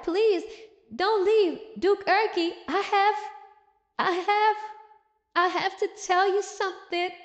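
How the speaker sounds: high-pitched mock-desperate begging, halting; tearful pleading. Woman running after the Duke to stop him leaving, mock-tearful plea. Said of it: "she's running and crying like".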